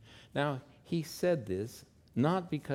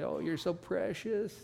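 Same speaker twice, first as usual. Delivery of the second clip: higher, sweet Southern Texas gush; Southern Texas woman; 8 semitones higher than usual. Retold or imitated. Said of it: "like a Southern Texas woman would say".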